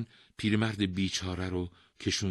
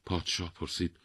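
ordinary delivery, nothing marked

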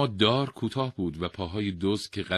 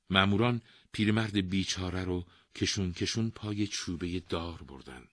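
plain reading voice

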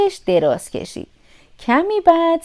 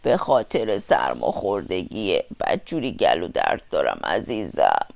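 very deep, soft wolf voice; thick-voiced disguised wolf; 8 dB quieter than usual. A narrator's reading. Disguised wolf blames a sore throat, deep thick voice. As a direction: fake a sore throat, very low. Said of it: "چرا صداتون کلوف شده".

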